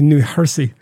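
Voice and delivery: "Jersey" said as "Hersey"; Swedish-accented "New Jersey" with breathed H; Swedish pronunciation. Retold or imitated. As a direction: imitate a Swede, H for the J in "Jersey". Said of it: "he also talked about".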